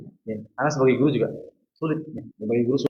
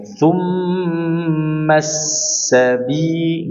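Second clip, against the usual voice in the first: slow plain recital without melody; simple recitation; 3 semitones higher, much slower than usual. Shown as a demonstration. Teacher showing the student the simple reading, slow. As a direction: read it slowly, no tune. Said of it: "gini cara bacanya gini aja".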